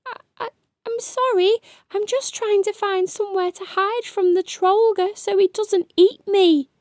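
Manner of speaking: high, fast, stammering fear; trembling voice. Acted. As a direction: stutter it out, scared of being eaten. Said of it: "with a trembling voice".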